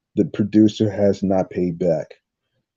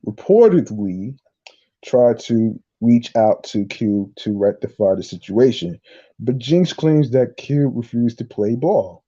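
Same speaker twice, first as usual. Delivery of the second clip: normal talking voice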